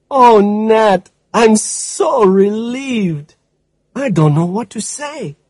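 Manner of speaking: lightly voiced grateful friend; relieved woman. A narrator's reading. Stella relieved on phone.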